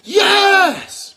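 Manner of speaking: very high, loud screamed cheer; long, piercing yell of triumph; full scream. Retold or imitated. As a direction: scream it out like an ecstatic coach. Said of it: "and I screamed".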